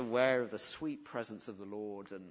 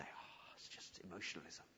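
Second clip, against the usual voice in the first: mock dismissive murmur; 9 semitones lower, 15 dB quieter than usual. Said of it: "Don't say".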